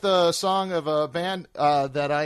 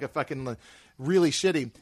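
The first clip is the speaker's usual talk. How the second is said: ordinary delivery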